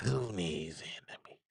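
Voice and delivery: whispered slow echo of who needs enemies. Shown as a demonstration. Host whispering the punchline back to himself. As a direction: breathe the line again as an aside.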